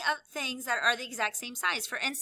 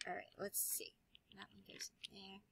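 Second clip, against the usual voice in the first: whisper; 5 semitones lower, 21 dB quieter than usual. Real, not acted. Teacher murmurs while placing cubes.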